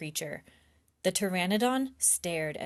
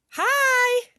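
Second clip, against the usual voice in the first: high, loud shouted greeting; a girl hailing a creature with a shout; excited shout; 11 dB louder than usual. Acted. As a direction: call out brightly, much higher and louder. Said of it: "Annie shouted".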